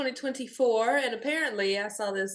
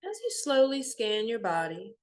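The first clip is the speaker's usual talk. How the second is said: softer meditation voice with long pauses; guided meditation; 3 dB quieter than usual. Real, not acted. Guide leading listeners through a body scan, calm meditation voice. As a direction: guide them gently inward, leaving long silences.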